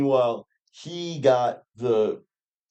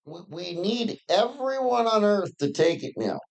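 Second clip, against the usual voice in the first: high mocking voice; mocking politician; 9 semitones higher than usual. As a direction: mimic him, pitched way up. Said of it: "he's like".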